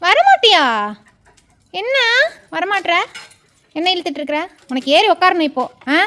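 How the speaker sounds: loud, exasperated questioning; scolding the dog. Real, not acted. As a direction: start high and loud, challenge a stubborn pet. Scolding dog that refuses to come.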